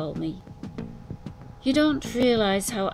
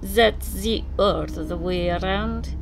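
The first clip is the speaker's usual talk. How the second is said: put-on Slavic accent; 4 semitones higher, 6 dB louder than usual. A narrator's reading. Narrator voices the Russian.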